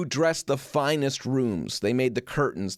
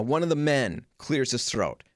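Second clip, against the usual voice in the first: usual speaking voice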